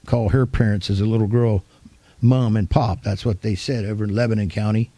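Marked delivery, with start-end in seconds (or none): none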